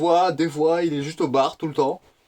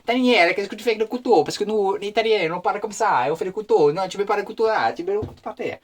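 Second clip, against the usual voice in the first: higher, lively Italian chef impression; mock Italian accent; 6 semitones higher, 4 dB louder than usual. Italian chef telling off trainee walking with a knife, mock Italian accent. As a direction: scold in a high-pitched hammed-up Italian accent. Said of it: "avec un accent italien à chaque fois".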